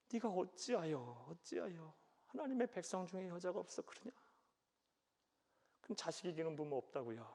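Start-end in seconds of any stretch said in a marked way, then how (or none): 0.1-4.1 softer, higher heartbroken parent; grieving lament. Retold or imitated. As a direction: sigh it out like a hurt parent. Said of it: "속상해하면서, 막 한탄하면서, 마음 아파하면서". (속상한 부모의 한탄)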